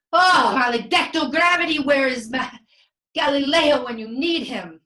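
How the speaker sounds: high, loud theatrical cursing; exasperated outburst. Acted. Leonardo curses gravity as his statue fails, exasperated. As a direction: rail at the heavens in frustration.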